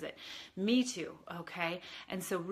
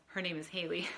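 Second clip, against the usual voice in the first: usual speaking voice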